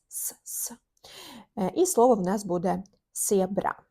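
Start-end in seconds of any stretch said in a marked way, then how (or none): 0.1-0.7 "с, с" as an unvoiced hiss; breathy "с, с" sound demo. Shown as a demonstration. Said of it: "тут буде завжди звук с".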